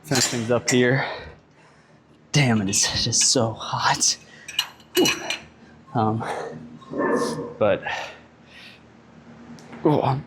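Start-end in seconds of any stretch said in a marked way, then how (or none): 2.3-10.2 breathy whisper. Real, not acted. Overheated in the gym.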